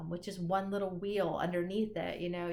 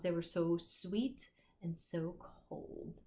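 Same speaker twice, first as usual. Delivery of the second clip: savoring slowly again; 3 dB quieter, slower than usual. Shown as a demonstration. Teacher repeats favorite line.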